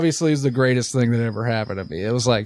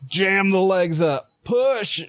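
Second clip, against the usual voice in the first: high, stretched delivery-room command; urging to push; 7 semitones higher, much slower than usual. Retold or imitated. Birth team to mother at a contraction, drawn-out urging. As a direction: imitate a coach urging a big push.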